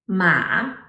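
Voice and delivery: "ma" dipping, then rising; third tone. Shown as a demonstration. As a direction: trace a U shape on "ma". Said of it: "recap these four tones".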